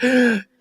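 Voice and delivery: sharp gasp of sudden shock; a quick hammed-up intake of breath; mock gasp. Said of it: "And she's just like".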